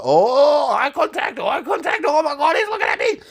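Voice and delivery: replaying the moment he locked eyes in fright; high-pitched mock panic; panicked whisper of awe. Narrator voices his own panic.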